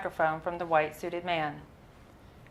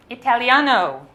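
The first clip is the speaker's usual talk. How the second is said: proud Italian; 5 semitones higher, 7 dB louder than usual. Retold or imitated. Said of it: "his hand to his chest".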